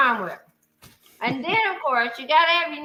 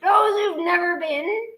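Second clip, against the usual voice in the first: solemn recital; 5 semitones higher, slower than usual. Shown as a demonstration. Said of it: "I'm gonna say it, and you're gonna repeat after me".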